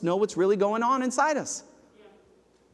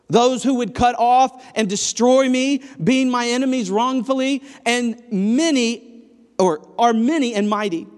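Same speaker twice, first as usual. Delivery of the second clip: louder, pleading scripture read; impassioned psalm reading; 5 semitones higher, 7 dB louder than usual. Acted. Preacher reads King David's cry for rescue, pleading and loud. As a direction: read it as a drowning man's plea.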